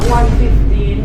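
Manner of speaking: loud scoffing at the offer; mock outraged. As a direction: scoff loudly at the lowball. Vendor rejects lower price.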